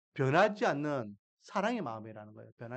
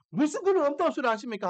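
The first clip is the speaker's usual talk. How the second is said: higher-pitched mock outburst; angry retort; 8 semitones higher, 4 dB louder than usual. Elder son rejecting his father's words, indignant retort. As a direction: snap back as the imagined indignant son. Imagined son refuses and overturns table.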